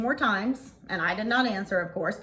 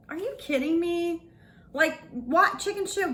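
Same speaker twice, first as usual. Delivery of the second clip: higher, disbelieving are you kidding me; incredulous retort; 8 semitones higher than usual. Retold or imitated. Answering the caller who called her chicken, mock disbelief. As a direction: scoff at the insult in disbelief.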